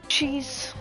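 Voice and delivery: normal talking voice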